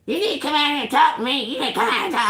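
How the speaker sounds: high, rapid mocking jabber; mimicked nagging neighbour. Neighbour nagging her to come out, high mocking imitation. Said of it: "Like that".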